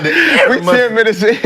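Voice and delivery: holding back laughter; talking through a suppressed giggle; stifled giggles. Real, not acted. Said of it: "I'm trying not to laugh".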